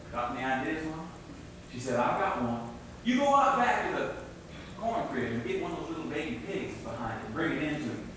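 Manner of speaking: unhurried folk-tale telling; storytelling narration. A narrator's reading. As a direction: spin the tale for the listeners. Folk tale told to audience.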